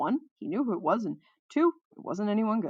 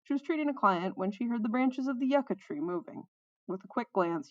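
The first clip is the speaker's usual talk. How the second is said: ordinary delivery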